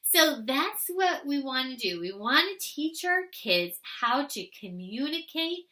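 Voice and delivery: normal talking voice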